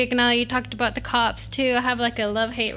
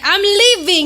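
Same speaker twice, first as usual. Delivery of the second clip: angry retort; 7 semitones higher, 6 dB louder, slower than usual. Retold or imitated. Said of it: "and I was like".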